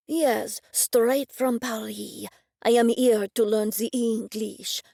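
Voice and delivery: put-on slow, lightly French-accented chef; fake French accent. Chef claiming to come from Paris, mild French accent. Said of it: "that fake French accent".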